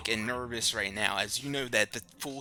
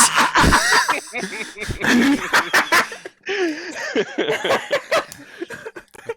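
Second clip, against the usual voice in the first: loud laugh; 12 dB louder than usual. Real, not acted. Laughing after mock shout.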